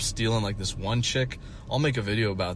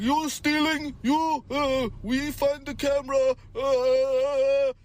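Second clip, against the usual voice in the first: Russian boss accusing; slower than usual. Retold or imitated. Boss accusing me of stealing, very high mock Russian accent. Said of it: "He's like".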